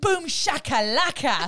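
high, loud, slow exclamation; catchphrase impression. Quoting her other catchphrase for friends, loud theatrical call. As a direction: punch out a showy catchphrase, stretching it. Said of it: "She goes".

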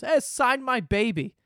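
high, slow pleading call; hammed-up request, pitched high; mock eager parent. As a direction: imitate an overeager fan calling out. Mimics parent pestering politician.

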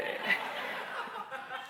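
soft airy laugh at himself; a quiet chuckle before the joke; breathy laugh. Real, not acted. Laughing at his own eating habits, a soft laugh. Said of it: "I'm a connoisseur".

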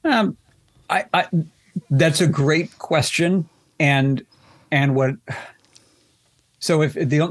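real hesitation, halting between words; stuttering and pausing. Real, not acted. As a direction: stall and restart while choosing careful wording. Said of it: "I'm sort of stuttering and pausing here".

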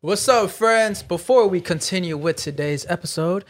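measured, announcer-like sponsor read. Acted. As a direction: deliver it like a podcast sponsor shout-out. Reads sponsor ad.

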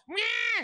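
high, drawn-out screech; mom's mock yell. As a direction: screech like an approaching mom. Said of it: "starts yelling".

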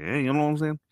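loose attempt at a movie tough-guy accent; De Niro. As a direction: slip into a light celebrity impression, rambling. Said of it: "I'm suddenly becoming De Niro".

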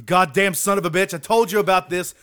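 loud, high mock-furious rant; swearing tirade. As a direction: blow up at him in hammed-up rage.

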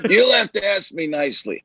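Jack Nicholson. Acted. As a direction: put on the star's voice and milk the line. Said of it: "do Jack Nicholson in front of".

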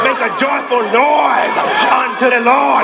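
shouting preacher. Retold or imitated. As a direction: call and response preaching. Call and response sermon.

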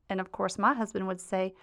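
usual speaking voice

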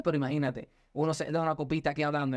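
normal talking voice

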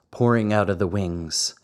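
usual speaking voice

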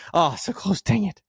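high-pitched gasping groan of defeat; just missed it, annoyed; exasperated. Real, not acted. Failed the one-breath read.

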